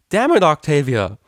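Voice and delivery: loud exasperated yell; frustrated shout. Acted. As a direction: throw off the covers and shout it. DJ ripping sheets off, frustrated.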